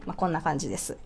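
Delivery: ordinary talk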